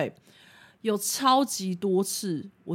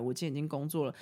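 ordinary talk

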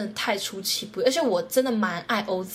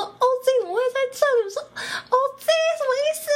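much higher, fast, disbelieving squeal; amazed squeal; 3 dB louder, faster than usual. Retold or imitated. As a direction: gasp out the questions in shocked delight. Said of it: "我就这个超级惊讶". (超级惊讶地尖声发问)

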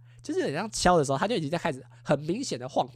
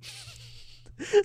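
high laugh. Real, not acted. Laughing at the memory.

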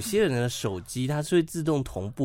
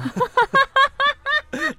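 high, loud burst of laughter; 8 dB louder than usual. Real, not acted.

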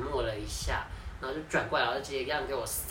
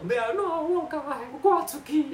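crying on the phone; 13 semitones higher, 4 dB louder, slower than usual. Retold or imitated. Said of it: "然后这样哭".

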